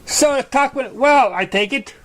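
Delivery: high, bubbly pony voice popping up; Pinkie Pie voice. A narrator's reading. Pinkie Pie surprising Apple Bloom from behind, high and bubbly. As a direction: sneak up and chirp it cheerfully.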